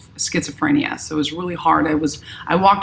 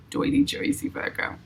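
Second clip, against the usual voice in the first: put-on higher, whispered New York accent, playful; mock New York accent under her breath; 9 semitones higher, 7 dB quieter than usual. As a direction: try the New York accent on dirty Jersey. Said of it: "I got the New York accent".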